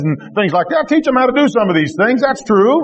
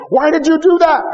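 exasperated parent; 8 semitones higher than usual. Retold or imitated. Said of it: "parents always asking their children".

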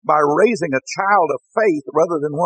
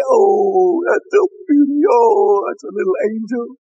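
high-pitched, cooing church ladies; hammed-up adoring baby admirers; gushing over baby; 5 semitones higher than usual. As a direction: coo and fuss over someone's baby. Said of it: "they say".

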